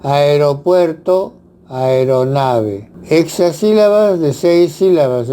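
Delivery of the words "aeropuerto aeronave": slow, syllable by syllable; teacher slowly enunciating example words; slow careful example words. Shown as a demonstration. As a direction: spell out each syllable slowly for the class.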